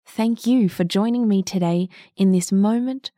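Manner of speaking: low, calm mindful voice; guided meditation. Acted. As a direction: ease them gently into calm.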